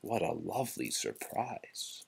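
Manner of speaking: usual speaking voice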